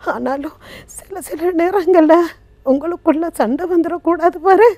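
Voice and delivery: acted mother's plea, halting with long pauses; pleading mother. Abandoned mother to her son in an old-age home, pleading. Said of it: "அந்த தாய் பேசுற மாதிரி கதைச்சேன்".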